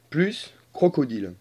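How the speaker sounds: ordinary talk, nothing marked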